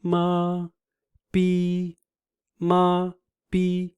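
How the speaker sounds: MA and PI each held high and level; same steady high pitch on MA, PI; flat first tone. Shown as a demonstration. Teacher alternating syllables in the same tone, high and flat. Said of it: "exactly the same tone".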